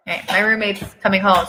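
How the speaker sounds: ordinary delivery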